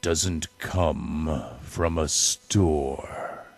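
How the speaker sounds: tender dawning wonder, word by word; quiet realization. A narrator's reading. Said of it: "he thought".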